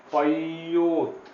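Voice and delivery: teacher modelling पयोत slowly; slow model reading. Shown as a demonstration. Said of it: "यहाँ भी softness है".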